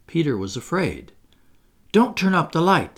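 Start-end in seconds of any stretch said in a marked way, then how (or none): 1.9-3.0 high, louder alarmed plea; frightened cry. A narrator's reading. Peter, afraid, begs Wendy about the light, crying out. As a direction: cry out to stop her. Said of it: "he cried".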